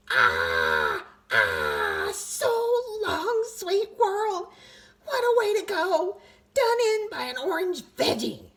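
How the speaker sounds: milk the death scene; hammed-up dying speech. A narrator's reading. Tooth bidding the world farewell, melodramatic wail.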